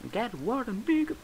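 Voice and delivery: high put-on voice after a laugh; amused high-pitched imitation; mimicked catchphrase.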